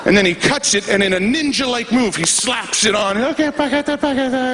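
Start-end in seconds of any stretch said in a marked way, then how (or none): 3.2-4.5 high, foreign-sounding gibberish sign-off; high babbling put-on voice; Latka from Taxi. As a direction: chirp nonsense syllables in a high mock foreign voice. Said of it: "if you remember Latka from Taxi".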